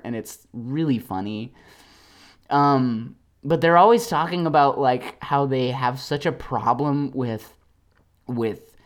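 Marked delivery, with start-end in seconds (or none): none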